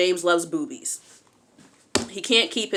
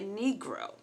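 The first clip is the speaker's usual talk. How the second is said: unimpressed reaction; 3 semitones higher, 12 dB quieter, much slower than usual. Retold or imitated. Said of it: "I'm looking at him like".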